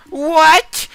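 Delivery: very high, loud shocked shout. Acted. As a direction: yell it in total shock. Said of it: "shouted Emma".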